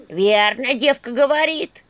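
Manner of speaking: loud, drawn-out creaky character voice; creaky staff. A narrator's reading. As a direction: creak out your approval, rising high. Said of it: "скрипучий голос посоха". (скрипучий голос посоха, громко)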